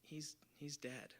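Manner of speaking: halting, very soft and slow; hushed sad confession. Retold or imitated. Crying girl revealing her loss to a stranger, hushed and halting. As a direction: barely get the words out, near whisper.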